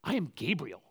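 I am Gabriel as a firm rebuke; lightly voiced offended Gabriel; a hint of an indignant angel. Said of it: "Gabriel goes".

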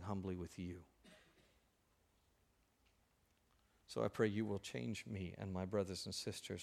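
soft earnest prayer voice. Real, not acted. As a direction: pray quietly for the people. Pastor leads closing prayer.

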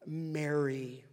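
tender call. Acted. Jesus calls her name softly.

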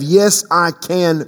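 slow, drawn out Yes, I can; slow faith declaration; slow affirmation. Shown as a demonstration. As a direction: stretch it out as a declaration. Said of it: "You have to have".

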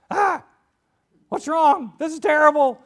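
high, loud panic; frantic shrill alarm; panicked. Acted. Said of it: "left there to panic".

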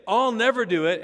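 very loud, high mimicked protest; vehement vow. Preacher replays Peter's boast to Jesus, vehement vow. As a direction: belt out an overconfident refusal.

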